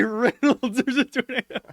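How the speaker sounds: high imitated warning, on the edge of laughter; mock-panicked high voice; Shiloh. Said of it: "nice enough to say".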